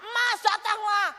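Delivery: shouted, high money command; mimicking her frantic prayer for money, shouting. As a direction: call the money to come, hammed-up. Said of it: "Saudara lihat gaya doa nih".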